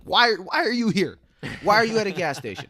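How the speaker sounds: high, loud incredulous yell; bewildered outburst at a coworker; exasperated shout. Retold or imitated. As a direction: shout it in disbelief at the guy. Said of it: "I'm like".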